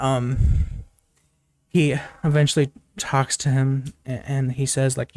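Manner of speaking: normal talking voice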